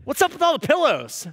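high-pitched incredulous challenge; acted-out tactless husband's complaint; not so kindly. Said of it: "not so kindly, say".

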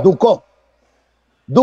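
"Duco" said du-CÓ, accent at the end; stress on final O. Shown as a demonstration. Answering whether it has an accent, "Duco" stressed as du-CÓ. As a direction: repeat "Duco" with a firmly accented last O. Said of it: "¿Tiene acento en la O?".

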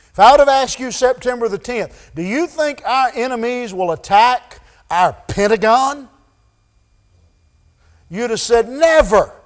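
high, loud, fervent sermon climax; preaching at full force. Acted. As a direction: preach it out, urging them to look.

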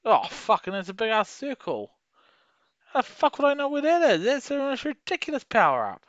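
high, loud frustrated yelling at the game; angry outburst. Real, not acted. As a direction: rage at the power-up, shouting. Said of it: "that's got me angry".

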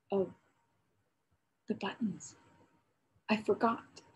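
put-on a small guilty boy remembering too late; stammering. A narrator's reading. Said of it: "stammered Joris".

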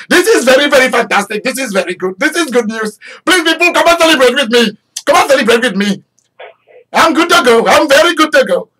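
put-on very fast, high, loud Indian-accented excitement. Mordecai invites people to celebrate, in a fast Indian accent. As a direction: race through it in an Indian accent, thrilled. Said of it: "they talk very fast".

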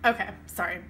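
ordinary delivery

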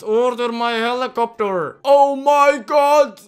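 loud, high pleading shouts; loud frantic pleas at the screen; panicked yelling. Real, not acted. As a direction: beg the attacker to stop.

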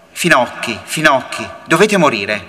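ordinary delivery

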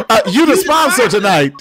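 teasing through laughter; laughing. Real, not acted. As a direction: say it laughing and loud. Teasing the co-host.

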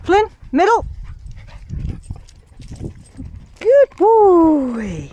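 high, loud, sing-song praise; excited dog praise. Real, not acted. Owner praising and calling the dogs, loud sing-song. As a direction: gush over the dogs at full volume.

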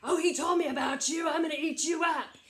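loud, fast troll threat; menacing troll. Acted. Troll to the middle goat, loud fast threat. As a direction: shout it quickly and threateningly. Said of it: "And that troll says".

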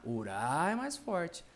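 ordinary delivery

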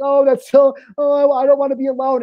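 high, loud, hammed-up sobbing whine; mock crying. Man crying at a sappy movie ending, hammed-up high wail. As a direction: wail it like a lonely guy at a sad movie. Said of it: "And I'm crying like".

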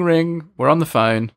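ordinary talk, nothing marked

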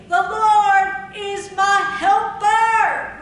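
very loud, high, slow shout; bold declaration. Shown as a demonstration. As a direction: proclaim it boldly to the church. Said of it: "confidently and boldly say".

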